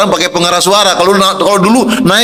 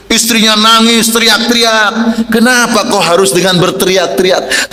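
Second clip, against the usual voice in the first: ordinary delivery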